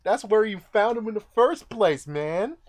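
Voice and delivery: shrill high "what?" then protest; can't believe what he just said; mock outrage, pitch shoots up; incredulous. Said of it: "I'm like".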